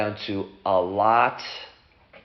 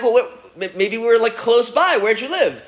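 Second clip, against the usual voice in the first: high, loud, eager questioning; eager excitement; 11 semitones higher, 4 dB louder than usual. Acted. One namesake asks where the other lived, eager excitement. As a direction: push it up high, hungry for the answer.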